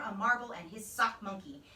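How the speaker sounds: usual speaking voice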